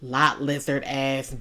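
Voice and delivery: ordinary talk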